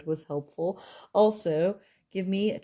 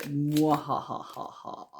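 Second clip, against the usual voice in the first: slow, hammy evil cackle; mad scientist laugh; slower than usual. Acted. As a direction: mad scientist cackle over the dark cards. Dark side reveal, evil laugh.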